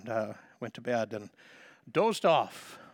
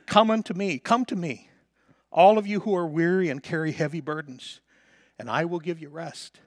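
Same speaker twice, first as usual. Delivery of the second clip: preaching scripture; 4 semitones higher, 6 dB louder than usual. Acted. Quoting scripture with force.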